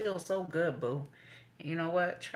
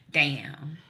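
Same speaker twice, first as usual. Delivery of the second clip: usual speaking voice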